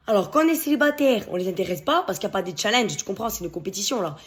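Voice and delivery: usual speaking voice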